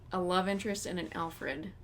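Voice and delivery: ordinary talk, nothing marked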